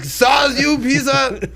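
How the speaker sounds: high, loud shout in a mock Norwegian accent; hammed-up Norwegian outrage, shouted high. Imagining offended people in Norway, shouting in a mock Norwegian accent. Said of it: "people in Norway being like".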